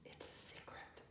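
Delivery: hushed secret; whisper. A narrator's reading. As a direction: breathe it out barely audible. Boy whispering his secret.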